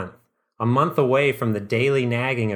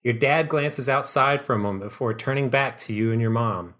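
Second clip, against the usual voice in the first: ordinary talk, nothing marked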